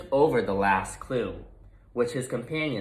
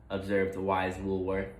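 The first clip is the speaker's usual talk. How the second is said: plain reading voice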